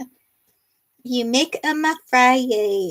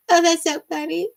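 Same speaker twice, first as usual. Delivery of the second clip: normal talking voice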